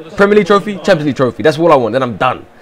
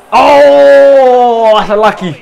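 high, loud stunned "Oh"; sudden loud gasp of surprise; surprised cry; 8 semitones higher, 5 dB louder, much slower than usual. Real, not acted. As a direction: yelp at the lucky bounce. Reacts to a lucky goal.

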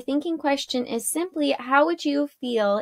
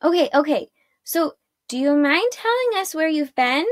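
higher, pushy question; raised-pitch, mildly rude interjection; impatient interruption; 6 semitones higher than usual. A narrator's reading. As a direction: cut in, pressing for an answer. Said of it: "Arella interrupted again".

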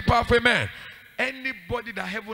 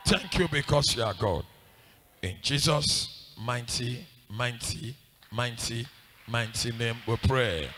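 low, slow prayer; solemn prayer; 8 semitones lower, 4 dB quieter, slower than usual. Real, not acted. Preacher closing the prayer in Jesus' name, low and slow. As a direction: draw the words out slowly and low.

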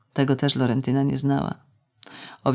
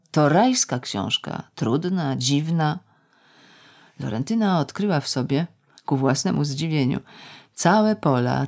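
ordinary delivery

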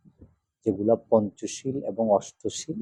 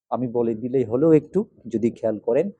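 ordinary talk

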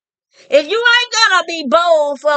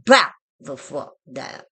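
low commanding order; 11 semitones lower, 5 dB quieter than usual. Real, not acted. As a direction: order them down from a throne. Commanding enemies to submit.

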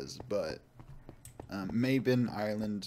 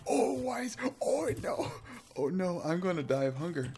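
much higher, desperate cry; panicked wail; 9 semitones higher than usual. Real, not acted. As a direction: whine that you're starving. Starving in game, panicking.

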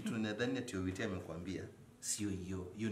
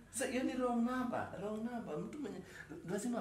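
high-pitched insisting; 11 semitones higher than usual. Acted.

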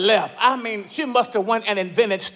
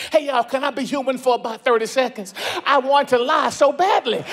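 usual speaking voice

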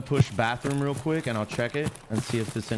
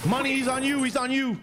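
high, urgent warning shout; urgent callout. Real, not acted. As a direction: shout the warning to your teammate. Warning teammate of enemy.

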